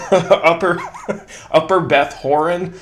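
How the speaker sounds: giggling through the words; laughing. Real, not acted. Laughing through the place name.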